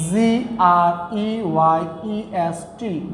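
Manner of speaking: slow, separated letters; spelling out. Shown as a demonstration. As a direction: spell it slowly, one letter at a time. Spells the word for students. (ধীরে আলাদা করে বানান বলা)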